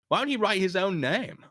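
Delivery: ordinary delivery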